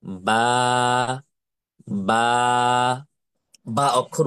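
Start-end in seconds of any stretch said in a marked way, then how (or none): 0.0-3.0 "বা" held long and level; drawn-out letter name. Shown as a demonstration. Teacher leading class repetition, long level "বা". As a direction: say each "বা" long and steady, with a gap between. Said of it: "আমার সাথে সাথে বলেন".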